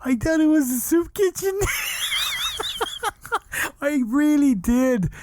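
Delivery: high, laughing voice; giggling through the punchline. Real, not acted. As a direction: say it still cracking up.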